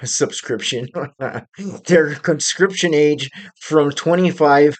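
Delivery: real laughter breaking into the words; cracking up. Real, not acted. Vlogger slips saying subscription for conscription, cracking up. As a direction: laugh at your own slip, trying to carry on. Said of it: "I cracked myself up".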